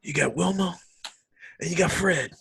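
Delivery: lightly voiced wrestler calling names; Jake the Snake voice. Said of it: "in the Jake Snake Roberts voice".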